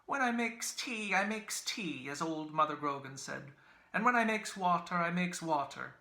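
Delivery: high, loud wheedling crone; old woman's wheedling voice. A narrator's reading. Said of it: "in an old woman's wheedling voice".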